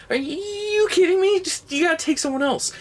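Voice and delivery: squeaky high disbelieving protest; mock a panicked guy begging off; incredulous high-pitched mock refusal. Comedian mimics movie character refusing to go to space, high and squeaky.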